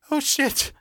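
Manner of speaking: squeaky yelp, very high; startled yelp. A narrator's reading. Chase hearing a shotgun loaded, high yelp. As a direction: yelp it in alarm, pitch leaping up. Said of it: "Chase yelped".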